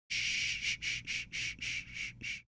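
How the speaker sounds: soft repeated shh; shushing. Real, not acted. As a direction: hush them down.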